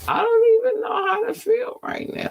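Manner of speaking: high squealing laugh-cry through the words. Real, not acted. Host overwhelmed before quick topics, squealing laugh-cry. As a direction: break into a squeal, half laughing half crying. Overcome mid-sentence, cry heard.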